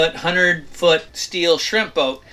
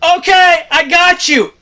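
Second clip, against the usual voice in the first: high, louder easy-going reply; captain agreeing; 5 dB louder than usual. Retold or imitated. Captain finally agreeing to fenders, a lightly voiced high casual okay. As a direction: give in breezily as the captain. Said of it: "The guy's like".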